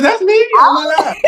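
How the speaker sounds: high, squeaky astonished question; can't believe it's really you; way up high, stunned at his own picture; shocked disbelief. Retold or imitated. Repeats disbelief at photos.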